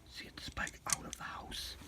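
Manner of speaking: whispering; quiet at night. Real, not acted. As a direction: keep it to a whisper. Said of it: "Wife is sleeping".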